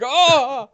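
mock orc yelp.

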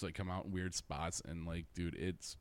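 ordinary delivery

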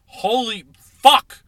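loud, high, slow curse; furious outburst; angry shout. Real, not acted. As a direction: yell it out in real frustration. Raging over the loss.

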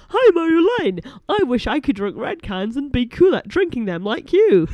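high, loud mock southern accent. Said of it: "southerner accent like".

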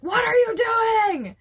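loud, slow, drawn-out shout at me; angry yell. Retold or imitated. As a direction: yell it like a furious husband. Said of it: "and he's like".

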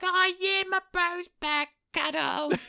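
very high squeaky falsetto; comic high-pitched princess voice; excited princess. Acted. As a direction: squeal with hammed-up sisterly delight. Princess Olga welcomes her brother.

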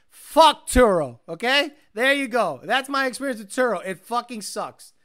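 frustrated rant. Real, not acted. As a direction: vent your frustration loudly.

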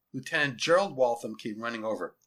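plain reading voice

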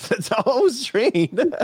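repeating it high through laughter; laughing echo. Real, not acted. Friend parrots his excuse while laughing.

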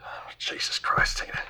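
breathy, slow, whispered groan of despair; exasperated whisper. Acted. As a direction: sigh it out under your breath in a cockney accent. Fixer exasperated at Tina's question.

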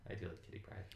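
breathy whisper, almost to herself; softly whispered confession of fandom; whispered aside. Real, not acted. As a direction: murmur it fondly under breath. Fond aside about Kitty Pryde.

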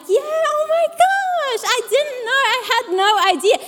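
high, overdone mock astonishment; fake surprise. Mocking people who fake their party surprise. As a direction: ham up surprise you don't feel. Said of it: "they fake it and they're like".

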